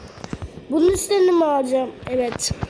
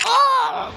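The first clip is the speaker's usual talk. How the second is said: sudden high yelp; sharp high-pitched gasp of shock; startled cry; 9 semitones higher than usual. Real, not acted. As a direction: yelp in surprise. Startled in game.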